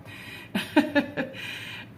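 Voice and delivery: breathy high laugh. Real, not acted. Laughs about her husband Tom.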